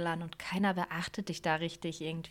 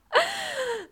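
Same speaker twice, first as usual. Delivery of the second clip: squealing laugh, much higher; high burst of laughter; 4 dB louder than usual. Real, not acted. Laughing at own silly idea.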